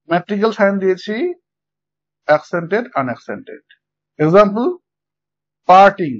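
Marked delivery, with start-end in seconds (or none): 5.7-6.1 "parting" with a loud first syllable part and a lighter টিং; stress on first syllable. Shown as a demonstration. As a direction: hit part in "parting" hard, leave টিং unaccented. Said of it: "পার্টের উপরে আমরা accent mark দিয়েছি". ("Parting" শব্দে প্রথম syllable পার্ট-এ জোর দিয়ে বলা)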